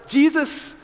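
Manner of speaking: high, loud desperate cry. Acted. Blind beggar cries out to passing Jesus, high pleading call. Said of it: "And so he cries out".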